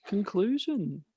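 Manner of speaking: normal talking voice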